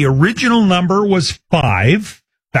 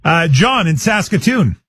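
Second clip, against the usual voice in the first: ordinary delivery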